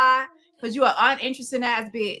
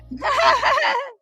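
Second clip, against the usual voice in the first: mocking laugh; 13 semitones higher than usual. Real, not acted. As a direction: laugh hard at her mistake. Mocking her redaction.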